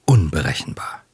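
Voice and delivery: plain reading voice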